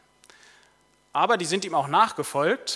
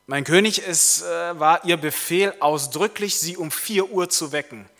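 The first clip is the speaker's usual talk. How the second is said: loud, raised, emphatic valet voice; insistent valet; 4 semitones higher, 7 dB louder than usual. Acted. Valet reminding the king of his order, insistent. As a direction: press the point loudly, word by word. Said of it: "Und der Kammerdiener sagt".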